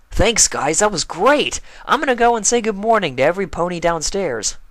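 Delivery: full and happy; young dragon voice. Acted. Spike voiced, cheerful.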